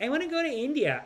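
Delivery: high, lightly mocking women's voice; imitating eager women. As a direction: lift the pitch to mimic a naive traveler.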